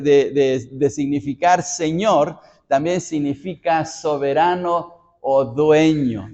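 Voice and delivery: ordinary delivery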